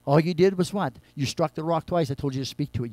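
ordinary delivery

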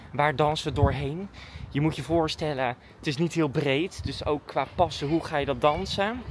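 ordinary delivery

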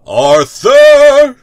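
high, loud call from another room. Acted. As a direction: yell his name across the flat. Hero calling from bathroom.

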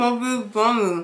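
mumbling through marshmallows; mouth full. Real, not acted. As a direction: say it with a stuffed mouth. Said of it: "if y'all know what [name] just said".

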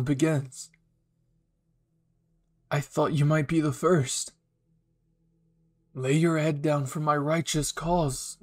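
put-on cold, righteous villain speech to an old friend; villain Kirishima. Villain confronting his old friend, cold and menacing.

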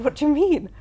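high, loud retold disbelief; shocked question. Host retelling her shock when Dar admits he cannot cook. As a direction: cry out in disbelief at the news.

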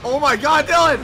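high, loud laughing shout; excited yell. Real, not acted. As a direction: yell out in shocked delight. Real shock and laughter.

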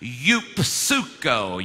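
"Eupsuko" very slowly, syllable by syllable, yoop-SOO-koh; slow teaching pronunciation. Shown as a demonstration. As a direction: stretch "Eupsuko" right out for others to join in. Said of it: "One more time".

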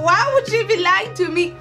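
ordinary talk

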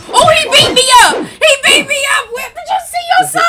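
rushed, high-pitched squawk of protest; high outraged yelling. Pretend. Mom beaten up by her son in game, high outraged yelling. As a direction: yell it high, as if under attack.

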